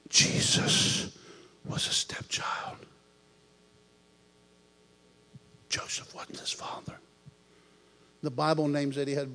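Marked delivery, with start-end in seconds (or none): 0.1-7.0 soft unvoiced whisper. The Holy Spirit whispering to him in the truck. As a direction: breathe it like a secret. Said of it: "the Holy Spirit whispered to me".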